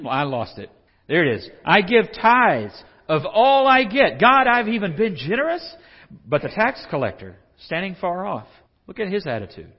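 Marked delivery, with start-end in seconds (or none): 1.7-5.6 loud, high self-congratulating prayer; boastful praying. Acted. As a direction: show off to God. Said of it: "Like he's trying to impress God".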